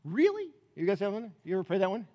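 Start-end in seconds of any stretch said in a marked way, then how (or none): 0.0-0.5 high, rising incredulous question; pitched way up, baffled; incredulous prayer. Acted. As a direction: ask God in sheer disbelief. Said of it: "my really prayer".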